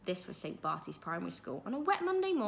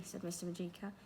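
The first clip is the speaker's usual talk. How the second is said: usual speaking voice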